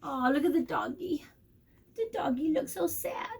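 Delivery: ordinary delivery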